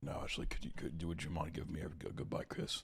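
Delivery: pleading in a hushed whisper; mock whispered plea. Joking to Ashley for a goodbye kiss, whispering. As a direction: beg softly, mock desperate.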